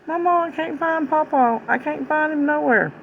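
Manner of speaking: high, wailing little girl's voice, retold; imitating a crying child. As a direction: mimic a grandchild sobbing for her lost teddy. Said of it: "she was just crying".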